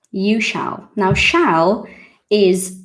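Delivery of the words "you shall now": ordinary delivery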